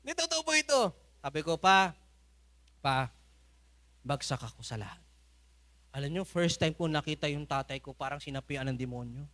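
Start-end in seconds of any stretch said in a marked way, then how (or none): 1.6-5.0 sad confession. Retold or imitated. Confessing failure to father.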